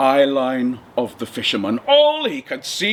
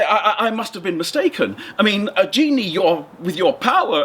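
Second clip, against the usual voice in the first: higher, nervous fisherman with a laugh; a frightened little man stalling for time, higher; 6 semitones higher than usual. Acted. Fisherman stalling the genie, nervous and higher-pitched.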